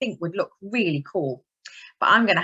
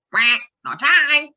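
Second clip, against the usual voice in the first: duck voice; 7 semitones higher, 7 dB louder, slower than usual. Acted. Duck answers in story.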